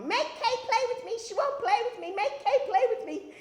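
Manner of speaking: very high, loud child's whine, imitated; pleading little girl. Little sister begging Mama for a playmate, high loud pleading. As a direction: beg Mama at the top of a small child's voice. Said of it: "I'd say to my mama".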